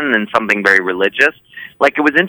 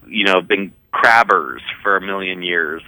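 ordinary talk

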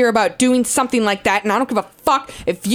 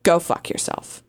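quiet sign-off; 8 semitones lower, 5 dB quieter than usual. Acted. Sign-off, dropped voice.

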